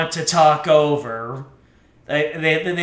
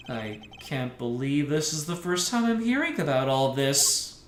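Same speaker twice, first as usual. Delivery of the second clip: ordinary talk, nothing marked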